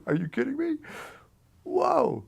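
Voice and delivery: high, stunned disbelief; astonished. Retold or imitated. Teen sees the manager loading a shotgun, stunned disbelief. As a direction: gasp it out in shock. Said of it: "I'm going".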